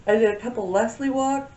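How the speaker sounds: hiccup breaking the words; speaking with hiccups. Real, not acted. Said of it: "I've got the hiccups".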